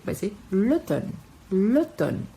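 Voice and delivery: l'automne said lo-TONN, the m silent; teacher correcting l'automne for students; silent m in automne. Shown as a demonstration. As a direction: glide over the m in l'automne, end on a clean n. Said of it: "não vai ser mener".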